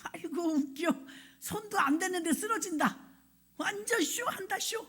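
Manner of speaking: a sceptic jeering at the fainting crowd; mocking scoff. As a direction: laugh it off as a fake show. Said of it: "제가".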